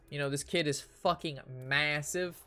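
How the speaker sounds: normal talking voice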